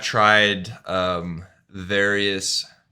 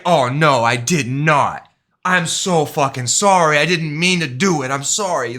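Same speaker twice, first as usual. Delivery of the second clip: remorseful apology; 4 semitones higher, 6 dB louder than usual. Retold or imitated. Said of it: "legit fucking remorse here".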